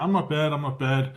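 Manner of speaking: normal talking voice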